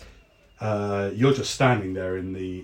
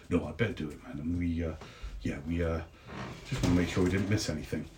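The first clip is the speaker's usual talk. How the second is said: lower, softer, hesitant guard; guard, low and quiet; 6 semitones lower, 8 dB quieter, faster than usual. Acted. Guard declining Deli's lunch offer, lower and softer. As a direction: mumble an excuse, dutiful but awkward.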